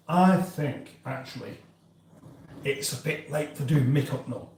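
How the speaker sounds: ordinary delivery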